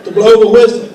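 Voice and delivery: loud, laughing call to stop; urgent correction. Retold or imitated. Old coach telling new coach about whistle, loud laughing holler. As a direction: holler at him over the noise, half laughing. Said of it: "Coach Frazier go there and go".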